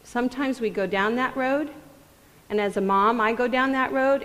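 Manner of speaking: usual speaking voice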